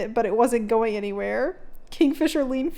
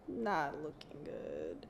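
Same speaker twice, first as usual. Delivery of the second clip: much softer and lower; muttered quietly; 7 semitones lower, 14 dB quieter, slower than usual. Real, not acted. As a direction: say it under your breath. Worrying about the pact.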